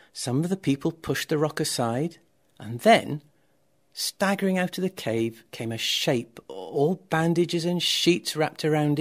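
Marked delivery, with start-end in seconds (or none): none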